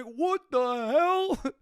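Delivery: mock disbelief. Stunned by free drinks.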